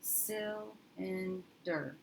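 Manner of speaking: Cylinder stretched very slowly with a short "sil"; slow corrected sounding out. Shown as a demonstration. Teacher retrying the word with a closed first syllable, slowly. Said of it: "And let's try that".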